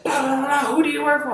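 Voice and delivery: very high mock-indignant voice; imitated bossy coworker. Imitates coworker pulling rank.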